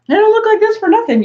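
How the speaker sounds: high and loud, a joking boast; self-mocking outburst. Real, not acted. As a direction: play up the joke about yourself. Joking about her own body.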